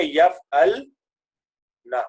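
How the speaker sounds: یفعلنا slowly split, ل closed without a vowel; slow teaching model of یفعلنا, resting on the ل; vowelless lam. Shown as a demonstration. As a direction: sound out یفعلنا as yaf-al, then na. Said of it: "ادھر بھی لام ساکن ہو جائے گا".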